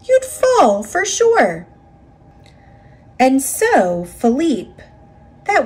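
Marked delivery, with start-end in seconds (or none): 0.0-1.7 much higher, mocking warning voice; scoffing officials. A narrator's reading. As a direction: scoff at the daredevil in a high voice. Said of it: "they would say".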